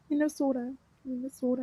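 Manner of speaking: soft, imitated Minnesota accent on "Minnesota". Shown as a demonstration. Showing that they do that too, soft Minnesota accent. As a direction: say "Minnesota" softly with the local accent's vowel. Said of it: "They do that too".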